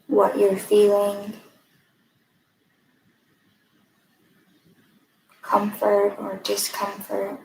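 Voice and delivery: calm, even guiding voice with long pauses; guided meditation. Acted. Mindfulness fellow leading a breathing exercise, guided meditation. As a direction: lead listeners gently inward, leaving room between phrases.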